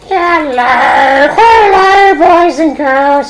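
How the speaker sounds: high, drawn-out puppet voice; showy puppet-show hello to children; Punch greeting. Acted. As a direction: sing out the greeting slowly and high.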